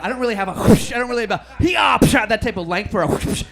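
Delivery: loud hammed-up whoosh noises; mock whoosh sounds, shouted.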